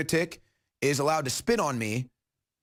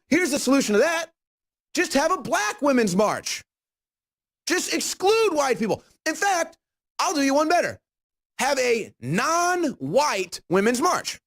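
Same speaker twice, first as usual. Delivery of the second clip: mocking; 9 semitones higher, 3 dB louder than usual. Real, not acted. Mocking raised voice.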